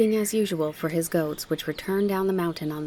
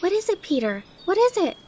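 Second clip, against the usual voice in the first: put-on high, excited child pressing for an answer; high-pitched eager pleading; insistent repeated question; 13 semitones higher, 4 dB louder than usual. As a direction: repeat it urgently in a little girl's voice. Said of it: "she repeated".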